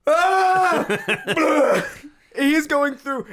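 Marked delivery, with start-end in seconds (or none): 0.1-1.9 loud, high hammed-up vomit scream; imitated puking kid's yell; mock retching wail. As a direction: scream as if heaving, exaggerated. Kid throwing up again.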